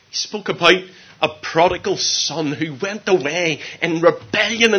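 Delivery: loud, high, swelling sermon delivery; impassioned preaching. Real, not acted. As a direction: preach it out at full force. Preaches at full force.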